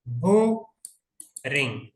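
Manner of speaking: बोरिंग very slowly, with a long gap before रिंग; teacher spacing out both halves of बोरिंग; two separate syllables. Shown as a demonstration. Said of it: "जिसमें दो syllable हो".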